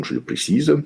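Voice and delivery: ordinary talk, nothing marked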